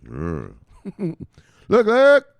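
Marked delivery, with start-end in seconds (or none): 1.7-2.3 high, loud excited look look; excited call. Real, not acted. Host spots new listing, calls excitedly. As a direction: grab the viewers' attention.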